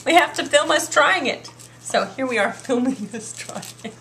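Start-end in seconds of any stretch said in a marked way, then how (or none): 0.0-1.5 higher, louder retold call-out; mock protest. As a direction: call him out in a playful retelling. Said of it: "and I'm like".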